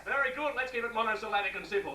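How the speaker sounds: ordinary delivery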